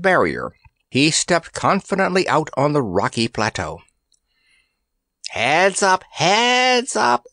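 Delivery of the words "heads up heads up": high, shrill creature call; shrill it out, higher the second time; shrill headsman. A narrator's reading. Headsman rallies his flying band, shrill call. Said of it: "shrilled the headsman".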